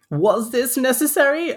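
high, loud offended reply; put-on outraged question; mock indignation. Mocking the poet's offended reply.

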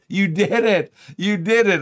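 put-on high, loud cheering for a child; proud dad cheering his little girl. Father cheering daughter's success.

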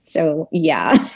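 ordinary talk

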